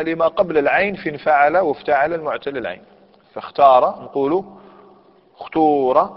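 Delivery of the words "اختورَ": ukhtūra with a long, full oo; pure damma. Shown as a demonstration. Teacher listing the three dialect forms, pure damma. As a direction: stretch the middle of اختور into a clear oo. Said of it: "وإخلاص الضم".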